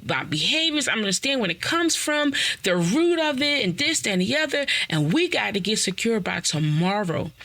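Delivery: high, excited announcing; breathless I-get-it-now; eager revelation. Retold or imitated. Running around telling everybody, eager and high.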